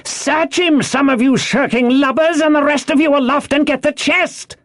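high, raging shouted orders; angry cry. A narrator's reading. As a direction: yell orders up at shirking men. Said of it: "he cried".

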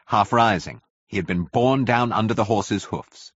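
plain reading voice

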